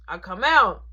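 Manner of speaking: ordinary delivery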